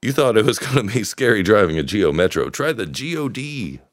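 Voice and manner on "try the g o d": car ad voice. Pretend. Mock car advert pitch.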